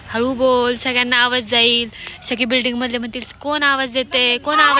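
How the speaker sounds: loud, insistent telling-off. Real, not acted.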